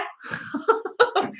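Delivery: high laugh. Real, not acted. Laughs at her own whatever.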